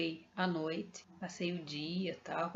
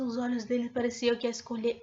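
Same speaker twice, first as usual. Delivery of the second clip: ordinary delivery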